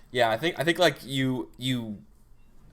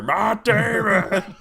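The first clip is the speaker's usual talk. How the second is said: very high, loud, stretched scream with a laugh; monster scream; 12 semitones higher, 10 dB louder, much slower than usual. Acted. Nemesis heard through the ship, screaming the name. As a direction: bellow the name out long.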